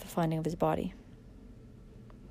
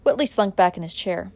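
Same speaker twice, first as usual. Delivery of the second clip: ordinary delivery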